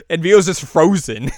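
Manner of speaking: usual speaking voice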